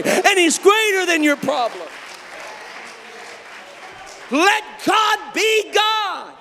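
loud, high shouted sermon peak; preacher shouting to the congregation that God is good; full-force preaching. Real, not acted. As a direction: shout it to the whole church.

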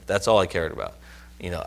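normal talking voice

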